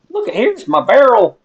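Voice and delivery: loud, high excited shout; suddenly thrilled at the find. Real, not acted. Spots his lost barrel.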